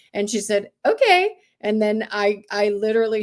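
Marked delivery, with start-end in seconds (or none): 0.8-1.4 skeptical okay. Retold or imitated. Neighbor's skeptical reply.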